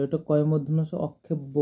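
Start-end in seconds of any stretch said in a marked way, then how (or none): 1.1-1.5 অক্ষেব্য as many say it, "kkh" for "ks"; common mispronunciation. Shown as a demonstration. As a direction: say অক্ষেব্য with a doubled "kkh", as "okkhebbo". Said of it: "অনেকজনে অক্ষেব্য বলে".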